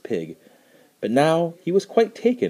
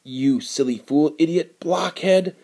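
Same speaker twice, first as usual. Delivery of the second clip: higher, louder angry scolding; furious guard; 6 semitones higher, 3 dB louder than usual. A narrator's reading. Guard rages at the foolish man, loud and angry. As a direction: berate him, building.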